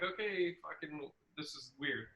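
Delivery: soft, low awkward mutter; awkward mumble. Retold or imitated. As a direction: mumble it while sneaking offstage. Comics trailing off stage.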